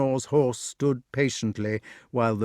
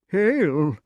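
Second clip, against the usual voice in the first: high, eager echo of a greeting; high-pitched kingly salutation; dotty king; 7 semitones higher, 4 dB louder than usual. Acted. Pellinore echoes Merlin's greeting.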